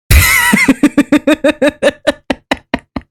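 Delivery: a bright, high burst of laughter; high giggle. Real, not acted. Streamer laughing at her see-through Minecraft head, high giggle.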